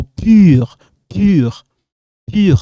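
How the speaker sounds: "pur" with tight French u, loud; French pronunciation. Shown as a demonstration. Teacher showing how we pronounce it, "pur" loud with French u. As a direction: demonstrate "pur" loudly with rounded lips. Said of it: "It depends how we pronounce it".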